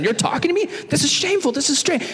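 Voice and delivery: high, startled woman's voice; shocked disbelief. Retold or imitated. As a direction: squeak it out in shock. Woman shocked Jesus speaks.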